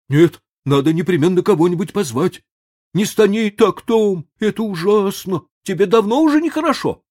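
high, loud urgent worry; fast anxious questions; frightened boy. A narrator's reading. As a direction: Sid pleading in fear. Sid frightened.

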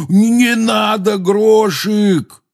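very high, loud, drawn-out yell; alarmed scream. A narrator's reading. Hagrid as the giant grabs at Hermione, screaming. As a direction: scream to stop him grabbing. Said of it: "услыхали они вопль Хагрида".

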